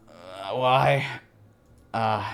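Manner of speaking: loud disbelieving cry; shocked outburst. Real, not acted. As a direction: blurt it out in shock. Reader reacts to the violent scene.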